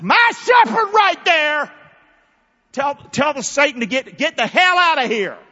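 high, loud preaching shout; preacher shouting the congregation against the devil; fervent shout. Real, not acted. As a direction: rail against Satan at full force.

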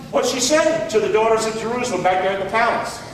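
normal talking voice

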